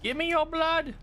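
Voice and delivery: very high, squeaky demand for blood; squeaky monster voice. Pretend. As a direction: play a tiny bloodthirsty monster. Silly monster voice while gaming.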